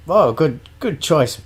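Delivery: lightly hammed fawning bartender. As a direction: bartender humouring the yuppie, lightly mock posh. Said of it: "the bartender sort of goes".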